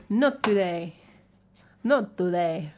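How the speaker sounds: light mock Mexican accent; emphatic, jokey Mexican-accented delivery; Puss in Boots. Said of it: "Puss in Boots kind of level of Mexican accent".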